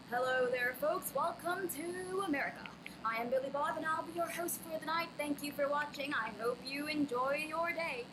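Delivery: big hammed-up American announcer; show host voice. Shown as a demonstration. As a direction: welcome the folks like a TV host. Show host in accent demo.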